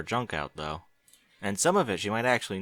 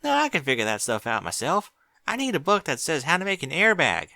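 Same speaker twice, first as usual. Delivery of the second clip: put-on high, eager little girl voice; young filly voice; 7 semitones higher than usual. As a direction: chatter like an excited little filly. Apple Bloom voice.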